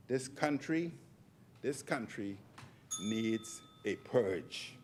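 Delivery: slow and soft, each word deliberate; slowly. Real, not acted. MP to Deputy Speaker, slow and hushed. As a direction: drop the voice and draw it out, very slow. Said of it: "let me say it slowly".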